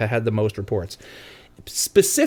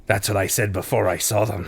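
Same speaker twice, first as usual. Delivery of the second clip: sea captain voice; 4 semitones lower than usual. Pretend. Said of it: "a different sea captain voice".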